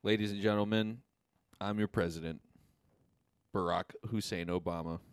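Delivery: a weak presidential address voice; Barack Obama impression. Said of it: "not even a good Obama impression".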